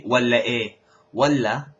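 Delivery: walla with a short final a. Shown as a demonstration. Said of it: "it's not wallah". (clipped walla)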